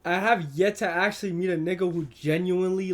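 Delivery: ordinary delivery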